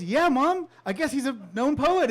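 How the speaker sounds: excited reply. Retold or imitated. Grandson calling back to his mom, excited. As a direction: call it out to mom with glee.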